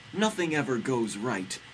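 mild mock yell; Anne Frank yelling. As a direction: read it as a half-hearted outburst. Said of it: "Anne Frank yelling".

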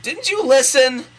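high, slow, loud scolding; hammed-up angry deity; yelling. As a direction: yell it drawn out in exasperation. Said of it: "yelled God".

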